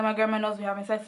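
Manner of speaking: rattled off very fast at normal volume; quick blurted repeat to boyfriend. Retold or imitated.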